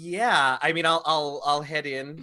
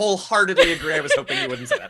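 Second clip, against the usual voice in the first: loud, high, through laughter; laughing; 8 semitones higher, 9 dB louder, much faster than usual. Real, not acted. Player laughing at game master's creepy camera, laughing. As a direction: agree while cracking up.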